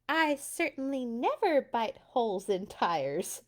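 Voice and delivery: cat spitting back a denial; mildly indignant cat retort; spat back. Acted. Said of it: "the cat spat back".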